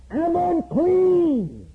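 high, slow, loud wail, acting a leper's warning; leper's loud cry. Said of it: "he was to cry out loud".

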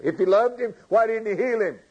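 high, challenging question; arguing doubters. Retold or imitated. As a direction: imitate skeptics demanding an explanation. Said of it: "That's the discussion going on among these people".

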